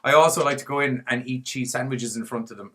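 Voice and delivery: ordinary delivery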